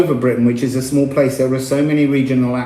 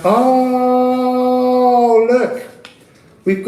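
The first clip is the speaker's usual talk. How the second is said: sudden high oh of discovery; surprised oh; 9 semitones higher, much slower than usual. Real, not acted. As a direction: exclaim it as if spotting something. Spots a card, exclaims.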